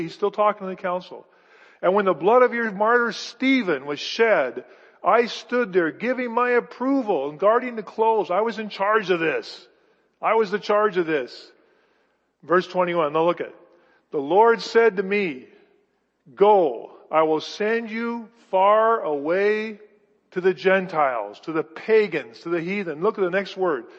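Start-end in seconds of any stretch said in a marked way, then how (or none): 1.8-8.3 loud, driving sermon voice; preaching at full force. Acted. Preacher reads Paul's words. 14.1-23.0 loud, slow, weighty call to the nations; slow preaching. Acted. Preacher reads God's call.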